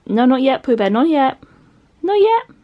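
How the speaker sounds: high, loud, coaxing call to a dog; talking to dog. Real, not acted. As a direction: hold the dog back in a high, loud pet voice. Calling off the excited dog.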